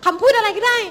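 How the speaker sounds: high-pitched imitation of eager fans; eager begging. Interpreter voicing fans following him from the shop, eagerly begging.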